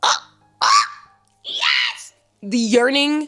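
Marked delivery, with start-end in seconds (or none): 0.0-2.1 high breathless squeal of joy; ecstatic squeal. Real, not acted. Reader squealing to viewers over the first kiss, ecstatic squeal. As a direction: squeal like the kiss finally happened.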